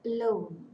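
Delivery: "alone" as a-LONE; weak first vowel, stressed LONE in "alone"; stress on root. Shown as a demonstration. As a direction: put the weight on the second syllable of "alone". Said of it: "the stress falls on".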